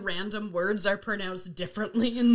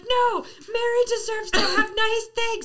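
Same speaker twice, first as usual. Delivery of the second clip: slow, high, loud mock wail; imitated screaming; 10 semitones higher, 5 dB louder, slower than usual. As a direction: wail in imitation of an upset viewer. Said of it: "And she's screaming".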